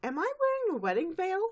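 high, puzzled teacher voice; Miss Cheerly voice. Retold or imitated. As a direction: ask it dazed and confused.

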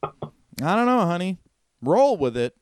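high, bemused shrug; resigned Eddie. Acted. Eddie tells his wife to roll with it, bemused. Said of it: "and he's like".

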